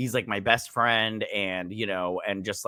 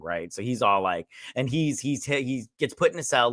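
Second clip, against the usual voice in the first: normal talking voice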